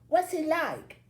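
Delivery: high, eager fangirl question; a little breathless. A narrator's reading. Girl asking Billy about Dustin, a little breathless. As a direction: ask it loud and high, starstruck. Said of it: "another girl asks a little breathlessly".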